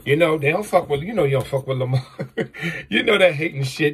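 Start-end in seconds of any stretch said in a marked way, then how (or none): 2.1-2.8 real quick low chuckle; short laugh. Real, not acted. Speaker laughing about haters who stayed away, short chuckle. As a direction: let out a brief laugh.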